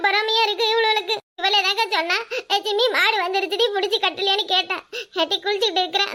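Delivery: put-on sobbing complaint; tearful wailing. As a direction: wail your grievance between sobs, playing the victim. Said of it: "எதுக்கு இப்படி அழுவுற". (அழுது புலம்பும் நடிப்பு)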